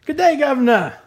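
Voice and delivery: high, hammed-up Cockney-Aussie greeting; bad Cockney Australian accent. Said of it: "It's a Cockney Australian".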